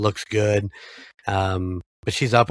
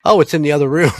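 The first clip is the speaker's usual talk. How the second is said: higher, louder carefree mom voice; breezy mom; 6 semitones higher, 8 dB louder, faster than usual. Retold or imitated. Mom shrugging off the missed calls, breezy and unbothered. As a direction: toss it off like it's nothing.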